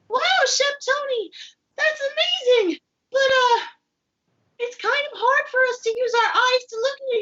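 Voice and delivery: high cartoon pea voice, puzzled; a little pea talking to the chef, high and chirpy; puppet pea voice. Pea puppet asking chef for help, high and curious.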